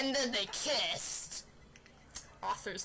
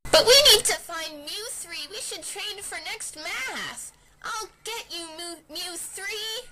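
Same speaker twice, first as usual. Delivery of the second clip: determined heroine; 8 semitones higher, 10 dB louder than usual. Acted. Sarah vowing to catch villain.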